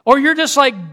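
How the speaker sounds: ordinary talk, nothing marked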